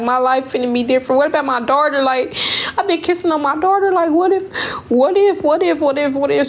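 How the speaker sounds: tearful panic over kissing her daughter; panicked crying. Retold or imitated. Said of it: "And I just started crying".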